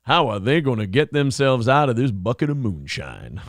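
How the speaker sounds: lightly voiced Southern narrator accent; a hint of a Dukes of Hazzard Southern accent; Southern good old boy. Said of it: "Just a good old boys".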